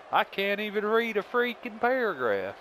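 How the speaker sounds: high, exasperated self-complaint; frustrated outburst after a botched read. Real, not acted. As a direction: groan at yourself in a high voice. Breaks off, exasperated at reading.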